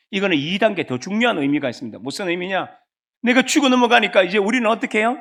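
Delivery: normal talking voice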